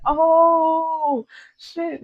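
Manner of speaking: high, drawn-out exclamation; mock stunned realization. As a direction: react as if freshly called out. Retelling her stunned reaction.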